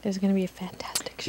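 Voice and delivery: whisper. Real, not acted. Echoing partner in hushed voice.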